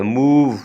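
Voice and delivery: मूव with ऊ held long; long vowel. Shown as a demonstration. Teacher repeating the word for students, मूव drawn out. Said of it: "आवाज़ को लंबा करना है".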